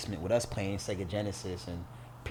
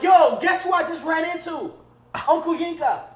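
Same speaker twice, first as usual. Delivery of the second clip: high, loud thrilled calling; bursting to tell his mom; excited; 9 dB louder than usual. Retold or imitated. FaceTiming his mom about the uncle, thrilled.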